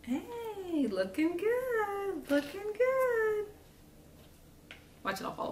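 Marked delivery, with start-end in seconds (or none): 0.0-3.5 high, drawn-out sing-song cheer; sing-song praise in a high little voice. Real, not acted. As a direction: coo it high, stretching each phrase out slowly. Cheering on gingerbread walls as built.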